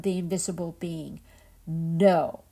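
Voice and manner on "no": loud, flat refusal; firm no. A narrator's reading. Invisible being's sister refusing the liars, firm loud no. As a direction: shut the lie down.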